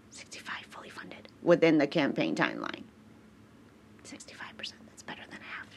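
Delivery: soft whispered aside. Real, not acted. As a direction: whisper it to yourself.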